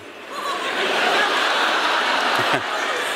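high breathy burst of laughter. Real, not acted. Amused partner bursting into laughter at the joke.

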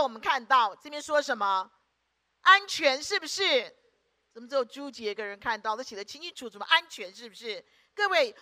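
usual speaking voice

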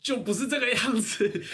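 high, snippy imitation of the lemon-hater; mock angry man. Imitating the angry man rejecting the question, high and snippy. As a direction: lightly mimic his prickly objection. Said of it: "然后就说".

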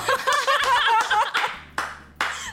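high burst of laughter; loud laughter. Real, not acted. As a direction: crack up loudly.

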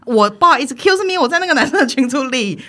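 an incredulous, loud retelling of her reply; indignant retort. Retold or imitated. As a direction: push back hard, fed up.

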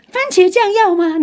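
high, slow, chirpy mock of a cheerful vendor; sunny vendor. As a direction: drawl it out like an overly sunny shopkeeper. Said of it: "用一种很阳光的脸就说". (用高而慢、装出来的阳光语气问)

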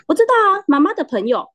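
imitating her own cheery answer; cheerful reply. Agent answering mom's friend on the phone, mock cheerful. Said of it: "我说".

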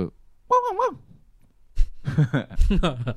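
高而慢的假狗叫; 学狗汪汪叫回敬. Acted. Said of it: "我就汪汪". (冲着叔叔学狗叫，高亢拖长)

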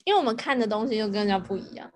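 ordinary delivery

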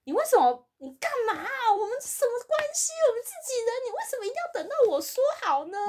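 high, loud mock-exasperated boss voice. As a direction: squeal it like the boss can't believe this. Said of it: "我老板就整个在那边说".

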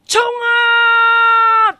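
high, loud "冲啊" held long; the angry rhino bellows as it charges forward; charging battle cry. Acted. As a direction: yell like a rhino starting its charge.

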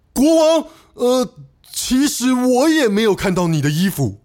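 sudden high call, then hesitant confession; blurting minister. A narrator's reading. Minister raising a hand, blurting a confession to the king. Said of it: "终于忍不住了".

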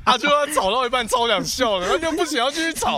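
high, loud, laughing through words; trying not to laugh. Real, not acted. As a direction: fight back the laughter while speaking. Laughs while describing held laughter.

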